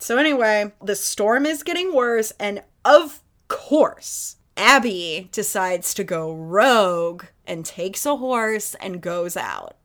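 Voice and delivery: ordinary delivery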